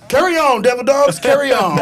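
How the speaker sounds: high, loud hammed-up command; imitating a first sergeant's order. First sergeant dismisses the marching platoon, mock loud command. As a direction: send the platoon off with a booming order.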